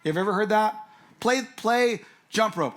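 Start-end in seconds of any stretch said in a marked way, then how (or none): 1.2-2.7 put-on a child pleading to play, drawn out; mock little kid asking to play; kid begging. A pastor voicing a child who wants to play jump rope. Child voiced in kids' quarrel.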